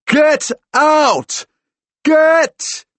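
high, full-force shouted command; bellow. A narrator's reading. Fighter beats the ghost out of a friend, bellowing. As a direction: shout it out in furious bursts. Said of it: "I bellow".

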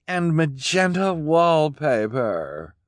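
high, slow affected drawl; drawling snob Esme. Acted. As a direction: stretch it out, terribly chic.

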